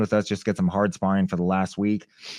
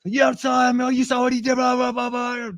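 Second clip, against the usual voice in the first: angry coach; 3 dB louder than usual. Retold or imitated. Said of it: "never seen [name] this angry".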